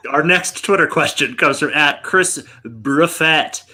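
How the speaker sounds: normal talking voice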